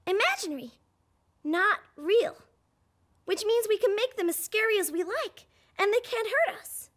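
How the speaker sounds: normal talking voice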